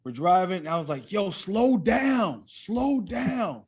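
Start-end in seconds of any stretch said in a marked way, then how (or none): none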